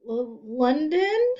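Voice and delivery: slow, high stammering guess; unsure and scared of being wrong; nervous. A narrator's reading. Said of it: "Leslie said nervously".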